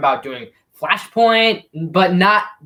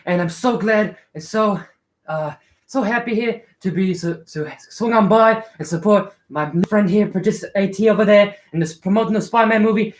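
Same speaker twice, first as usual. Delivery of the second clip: slightly higher put-on accent, badly faked; Tom Holland; 3 semitones higher than usual. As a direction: attempt a celebrity's accent as a friendly superhero greeting fans. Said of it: "I cannot do the accent".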